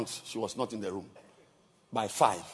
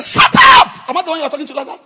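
stern shout; 22 dB louder, faster than usual. Retold or imitated. Bishop rebukes wife on call.

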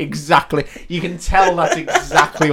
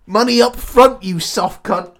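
mock tough voice; 8 semitones higher, 7 dB louder, slower than usual. Mock line of a character.

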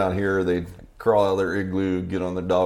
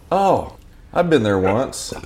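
lightly voiced waitress, higher and drawn out; slow, louder mock recollection; imitating a waitress; 5 semitones higher, 6 dB louder, slower than usual. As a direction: put on a chatty waitress a little. Acting out the waitress's reply.

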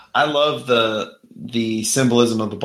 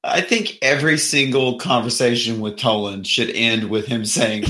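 ordinary delivery

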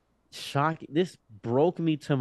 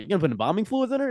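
sudden excitement; 7 semitones higher, much faster than usual. Retold or imitated. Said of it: "a flip switched in my head".

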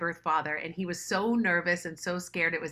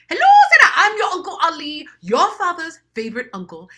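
much higher and louder, beaming greeting; eager African uncle; 10 semitones higher, 13 dB louder than usual. Retold or imitated. Uncle Ali phones Sarah, much higher and louder greeting. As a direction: sing out the hello like family.

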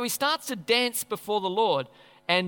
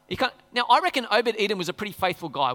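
normal talking voice

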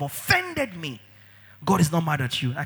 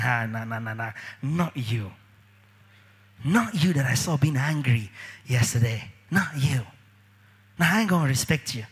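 dismissive; 6 semitones lower than usual. Retold or imitated. Mock dismissive church member.

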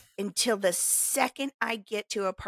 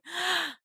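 a sharp, delighted gasp, higher; retold gasp; 8 semitones higher than usual. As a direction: gasp in wonder at the stalls. Delighted at the farmer's market.

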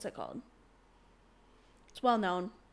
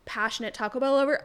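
ordinary delivery

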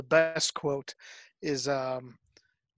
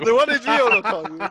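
laughing quote; 5 semitones higher, 7 dB louder than usual. Real, not acted.

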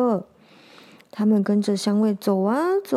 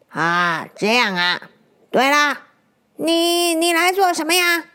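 put-on old granny softening, curious; a hint of a kindly grandma lizard; lightly voiced old granny; 5 semitones higher than usual. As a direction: ask the child why he came.

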